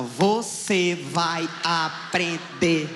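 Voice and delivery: very slow, each syllable hit; slow, beat-timed scolding. Retold or imitated. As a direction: spell it out like blows. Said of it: "me batia tão forte em sílaba".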